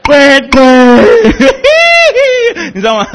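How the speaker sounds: 边大笑边高声喊"乖乖"; 笑到快哭出来; laughing hard. Real, not acted. (高声大笑)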